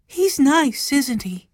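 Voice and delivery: put-on high girlish voice; high-pitched girl's question; young girl Violet. A narrator's reading. Violet speaking in story.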